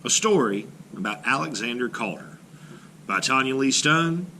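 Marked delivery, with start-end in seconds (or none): none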